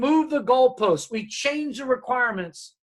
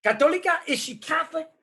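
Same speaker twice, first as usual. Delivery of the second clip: mother raising her voice; 7 semitones higher than usual. Retold or imitated. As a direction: press the question harder, pitched up. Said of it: "she raised her voice".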